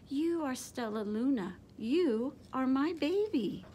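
plain reading voice

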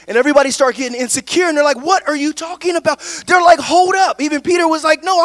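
fast, high retelling of the disciples' panic; animated preaching. Acted. Pastor voicing Jesus and the disciples, lively preaching. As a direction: preach the story at speed.

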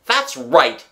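high, loud sarcastic shout. A narrator's reading. Jealous father sees Wendy hug Nana, shouting. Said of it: "he shouted".

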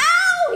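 loud, very high ow; yelp of pain. Acted. Child yelping as the dentist pulls, loud high pain cry. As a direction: yelp as the prickle comes out. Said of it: "I shouted".

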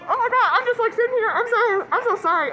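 high, loud, laughing apologies; giddy. Real, not acted. Starstruck vlogger apologizing to viewers, high and loud with laughter. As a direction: gush it out, can't contain herself.

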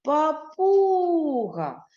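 папуга called out high and slow; calling out. Shown as a demonstration. Teacher asks class to call the word, high slow call. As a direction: call папуга out loud like across a field. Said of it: "Погукаємо".

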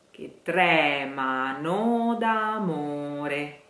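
che tremano d'amore slow and sung; slow model line. Shown as a demonstration. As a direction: stretch it out slowly. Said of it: "Let's skip the O".

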